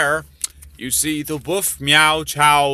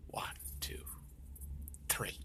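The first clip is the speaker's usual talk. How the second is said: whispered countdown; 25 dB quieter than usual. Real, not acted. Counting down before the shock.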